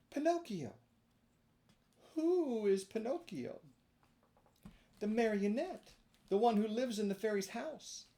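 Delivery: put-on high, pleading puppet boy; a small wooden boy asking to be let in; high marionette voice; Pinocchio voice. A narrator's reading. Pinocchio and snail exchange.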